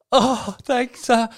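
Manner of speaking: high, put-on grateful voice; fake pleased thanks. Asking how friend takes a bad gift, fake pleased. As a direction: force out thanks as if about to fake-laugh. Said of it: "a fake laugh and go".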